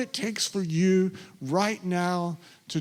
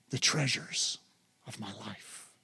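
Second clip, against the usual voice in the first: fading whisper; 6 semitones lower, 10 dB quieter than usual. Shown as a demonstration. Prayer fading out.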